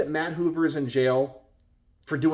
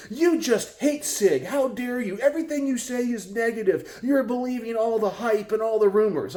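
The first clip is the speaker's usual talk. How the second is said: higher, hammed-up indignant rant; mock angry commenter; 7 semitones higher than usual. Quoting an angry YouTube commenter, mock indignant and higher. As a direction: sneer it like an offended commenter. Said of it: "I get two comments later".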